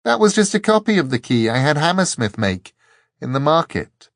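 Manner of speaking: triumphant. A narrator's reading. Dor revealing the copied key to the angel, triumphant. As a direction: call it over the wind in triumph. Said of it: "triumphantly, over the roar of the wind".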